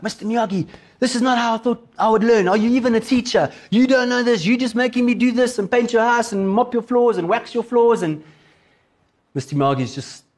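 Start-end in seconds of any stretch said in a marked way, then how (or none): 0.0-8.0 higher-pitched indignant tirade, acted out; mock-angry rant running on at high pitch; angry complaint. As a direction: play a fed-up pupil confronting his teacher. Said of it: "Daniel, so angry".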